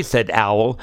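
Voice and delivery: ordinary delivery, nothing marked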